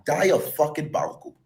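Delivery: ordinary delivery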